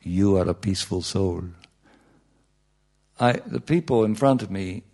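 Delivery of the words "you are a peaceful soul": low, calm meditation affirmation; soothing meditation voice. Acted. Speaker addressing the people in front, soothing meditation voice. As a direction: intone it low and serene.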